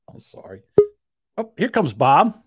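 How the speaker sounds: usual speaking voice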